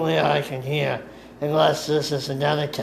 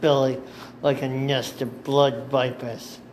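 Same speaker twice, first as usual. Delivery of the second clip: ordinary delivery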